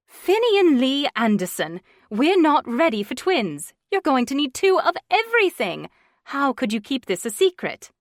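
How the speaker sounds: a mother wagging her finger, half laughing; mock scolding. Mother scolding her son for hiding the twins, high and loud.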